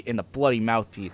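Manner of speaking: ordinary delivery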